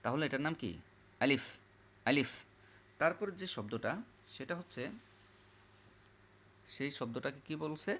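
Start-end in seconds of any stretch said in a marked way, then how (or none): 1.2-2.3 quick আলিফ, lips never meeting on the f; short, lips apart. Shown as a demonstration. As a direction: drill আলিফ short, with a rising tone and airy f. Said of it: "দুই ঠোঁট লাগবে না".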